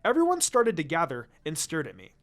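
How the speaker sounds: ordinary delivery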